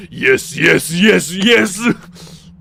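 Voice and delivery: loud, escalating yes and no shouts; hammed-up anime yelling; JoJo meme yells. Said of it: "edit that yes and no audio into JoJo".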